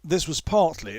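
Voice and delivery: usual speaking voice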